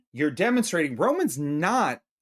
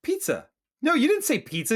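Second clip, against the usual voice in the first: incredulous; 11 semitones higher than usual. Retold or imitated. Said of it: "you're like".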